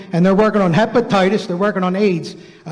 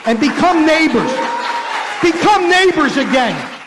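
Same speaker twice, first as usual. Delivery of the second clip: rousing shout; 6 semitones higher than usual. Real, not acted. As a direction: call out to the crowd to unite. Rousing call to the audience.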